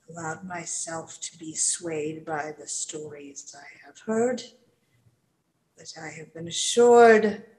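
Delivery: a proud warrior humbly making amends; solemn npc apology. Acted. Leaf humbly apologizing to Callista, soft and solemn.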